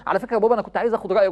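quick, rushed polite ask; eager request. Acted. Child asking father's advice, quick and eager. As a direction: blurt it out eagerly to dad.

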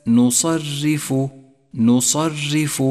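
نصرف chanted slowly with a thin, light ر. Shown as a demonstration. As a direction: draw out نصرف, keeping the راء light. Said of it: "الراء المرققة". («نصرف» بترقيق الراء)

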